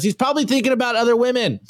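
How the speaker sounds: quick, lightly voiced fretting wife; a hint of a suspicious wife, rushed; concerned wife. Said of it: "And she goes".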